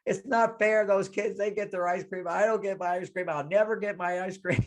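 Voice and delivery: high, whiny mock wail; whimpering kid protest, hammed-up; crying little girl. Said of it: "she started to cry".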